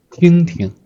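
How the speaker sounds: 听听 high level, then a light drop; first then gentle tone. Shown as a demonstration. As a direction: hold the first 听 high, let the second 听 fall light. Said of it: "is a gentle tone".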